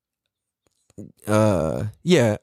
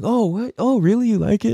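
mock surprise; 7 semitones higher than usual. Fake humble reply.

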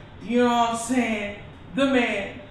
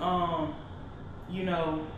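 ordinary talk

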